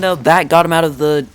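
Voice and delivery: usual speaking voice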